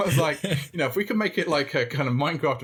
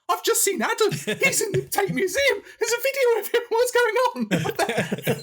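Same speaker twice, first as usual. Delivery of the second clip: very high, frantic hysterical voice; hysterical aunt. Retold or imitated. Aunt phoning mum in a panic about the video, shrieking high. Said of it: "she phoned my mum hysterically up".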